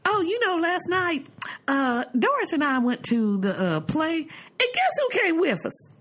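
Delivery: high, mock-excited mother voice; a lightly voiced chatty mother, sing-song; imitating mother. Daughter mimics her mother bragging about a guest, high and sing-song. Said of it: "she'll say".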